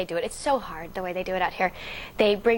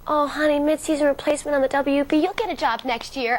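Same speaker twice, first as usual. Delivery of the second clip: higher, louder mock pity; 6 semitones higher, 4 dB louder than usual. Well-meaning people pity the actress over her show. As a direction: pat me on the head with your voice. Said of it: "the pity looks that I got from people".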